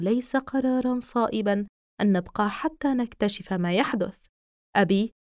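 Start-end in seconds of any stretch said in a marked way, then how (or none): none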